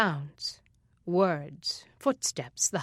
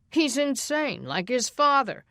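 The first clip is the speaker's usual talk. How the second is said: higher, louder bitter charge; angry accusation; 5 semitones higher, 3 dB louder than usual. Acted. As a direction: spit out the accusation. Father accuses Mark Green.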